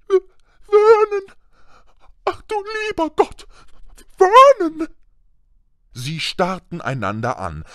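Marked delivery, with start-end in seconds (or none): 0.1-4.9 very high strangled wail; choked horror. A narrator's reading. Aunt horrified by the letter, choked high cry to husband. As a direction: shriek the name as if about to faint. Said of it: "gab ein würgendes Geräusch von sich".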